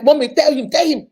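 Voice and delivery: loud urging plea; pleading wife. Retold or imitated. Wife to counselor, loud pleading. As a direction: beg the counselor to speak.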